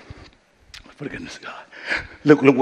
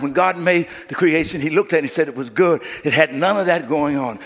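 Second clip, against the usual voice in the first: normal talking voice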